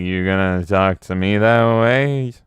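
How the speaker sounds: mock drunk menace; lightly voiced drunk Orson Welles. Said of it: "Orson Welles being like".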